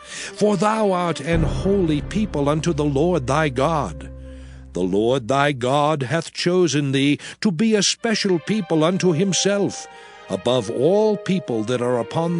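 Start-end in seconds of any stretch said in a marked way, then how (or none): none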